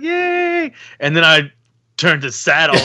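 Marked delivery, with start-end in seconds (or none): 0.0-0.7 very high imitated child's cheer; happy daughter cheering. Daughter celebrating using the toilet, happy high imitated cheer. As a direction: mimic a happy toddler's squeal. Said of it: "happy. She's like".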